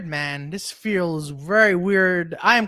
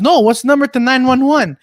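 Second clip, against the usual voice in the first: mock insistent cousin; 5 semitones higher, 6 dB louder than usual. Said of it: "He goes".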